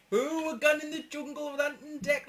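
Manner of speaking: a teasing opponent mocking their Geordie accent; mocking troll taunt. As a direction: parrot the troll teasing them about Ant and Dec. Mimicking a troll's Ant and Dec jibe.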